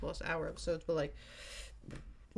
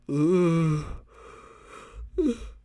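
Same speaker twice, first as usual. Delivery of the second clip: long loud weary sigh; tired sigh; 7 dB louder than usual. Real, not acted. Worn-out podcaster sighing mid-episode, long loud sigh. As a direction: let out a big exhausted sigh. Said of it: "I'm just so tired".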